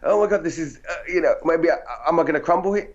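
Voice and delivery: gasp, then high-pitched mock panic; acted panic. Actor doubting himself before the director's suggestions, mock-panicked. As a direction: replay a nervous inner freak-out, pitched high.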